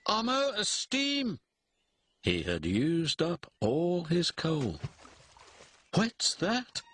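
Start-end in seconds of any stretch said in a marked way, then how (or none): 0.1-1.4 very high, dismayed engine voice; high-pitched stranded wail; distressed engine. A narrator's reading. Engine stranded on failing bridge. 5.9-6.8 high, nervous little engine voice; jittery high-pitched query; nervous question. A narrator's reading. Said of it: "he asked nervously".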